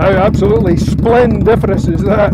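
loud, high-pitched delighted shouting with laughter; excited calling out. Real, not acted. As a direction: whoop over a roaring motorbike engine, laughing. Cheering on a loud motorbike.

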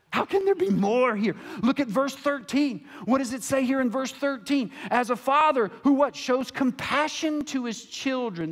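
loud preaching. Real, not acted. Preacher pressing the congregation on verse thirteen, loud. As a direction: raise it high and loud, questioning.

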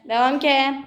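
normal talking voice

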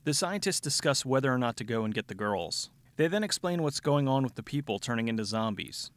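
usual speaking voice

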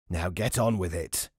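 low rasping devil voice; rasped. Acted. Devil rasping at the old woman's ear, low and rasping. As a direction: rasp it out, impatient. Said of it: "he rasped".

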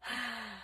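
long breathy sigh; heavy sigh. Acted. Exhausted teacher instead of replying, heaving a sigh. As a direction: heave a weary sigh. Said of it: "heaved a sigh".

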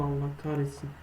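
usual speaking voice

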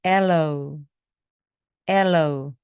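aloe stressed hard on the front; strong first syllable. Shown as a demonstration. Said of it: "앞을 강하게 해주시기 바랍니다".

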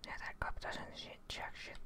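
usual speaking voice

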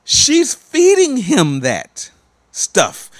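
very slow, loud, high on "feeding"; emphatic accusation. Real, not acted. Preacher condemns what the mother feeds her son, slow and loud.

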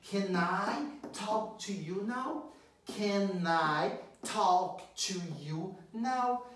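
very slow, auxiliary can up front; very slow model. Shown as a demonstration. Teacher stressing word order for students. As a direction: drag out each word. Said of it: "então você coloca ele aqui no comecinho".

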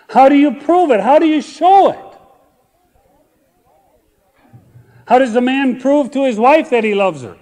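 high, loud preacher's cry; preaching at full force. Acted. Pastor challenging the congregation, loud preaching cry. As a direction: press the question on the crowd.

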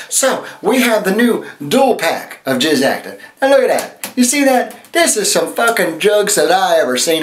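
high, sassy hostess voice pitching a product; sassy infomercial host JJ. Acted. Host to viewers, sassy high-pitched pitch. Plays drag character JJ hosting infomercial.

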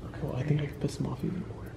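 quick scheming whisper. Real, not acted. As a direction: plot mischief in a whisper. Scheming aside about annoying Brandon.

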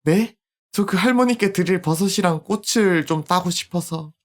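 a hint of a young girl; polite little girl voice; lightly voiced little girl.

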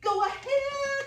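high, loud, slow sing-song cheer; excited. Acted. Pollywog cheers on the caterpillar, high and singing. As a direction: sing it out with glee. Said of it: "the pollywog said excitedly".

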